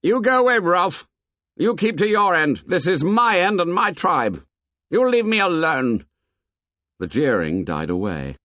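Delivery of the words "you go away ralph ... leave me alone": high, angry shout; hostile, territorial yelling; shouting over noise. A narrator's reading. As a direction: order him off at the top of your voice. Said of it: "Jack shouted above the noise".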